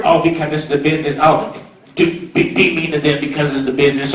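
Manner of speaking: mocking business excuse. Mocking cynical businessmen for the graduates, low and offhand. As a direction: play the shrugging cynic, low and quick. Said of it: "Do something not for".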